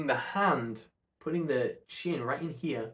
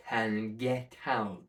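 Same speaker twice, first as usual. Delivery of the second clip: normal talking voice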